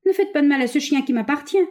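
put-on high-pitched old man's plea; mild stammering plea. A narrator's reading. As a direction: beg lightly for the dog. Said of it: "balbutia monsieur Bondona".

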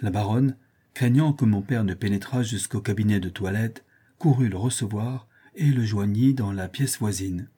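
usual speaking voice

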